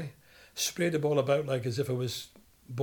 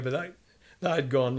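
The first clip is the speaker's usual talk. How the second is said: ordinary talk, nothing marked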